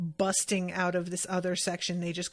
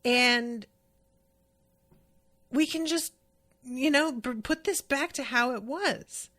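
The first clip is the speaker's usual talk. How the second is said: higher, eager mock Luffy plea; a lightly voiced higher Luffy, pleading; imitating Luffy; 5 semitones higher than usual. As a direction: pitch up and plead like a pleading kid captain. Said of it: "And Luffy is like".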